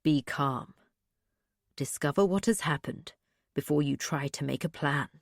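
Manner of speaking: low, soft inner voice of a steadying father; steady them quietly, pitched well down; calm. A narrator's reading. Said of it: "his voice was so calm in my mind".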